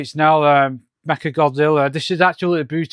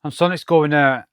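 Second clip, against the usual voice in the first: normal talking voice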